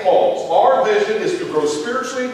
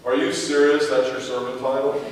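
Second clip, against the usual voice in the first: wife teasing; 7 semitones lower, 4 dB quieter than usual. Retold or imitated. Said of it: "rolls over and says".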